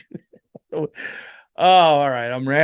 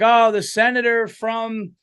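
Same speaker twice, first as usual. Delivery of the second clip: event announcer; 7 semitones higher, 3 dB louder than usual. Acted. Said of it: "they were like".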